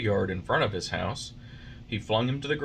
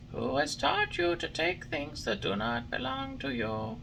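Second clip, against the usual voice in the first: high, softer scolding glowworm; 4 dB quieter than usual. A narrator's reading. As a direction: gently reproach the puppet.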